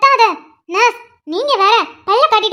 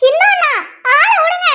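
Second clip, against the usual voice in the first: alarmed disbelief; 8 semitones higher than usual. Acted. Patient balks at second injection.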